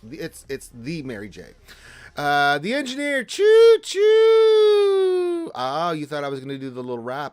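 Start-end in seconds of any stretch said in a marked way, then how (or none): 2.2-5.5 shouted high choo choo, much louder; train whistle call. Pretend. Greeting the engineer with a loud train call.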